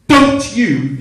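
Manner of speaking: high, explosive demand; sudden shout. Retold or imitated. Ralph barking at the singer in rehearsal, sudden shout. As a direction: fire it out as a sudden loud bellow. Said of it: "I've been shouted at by two people".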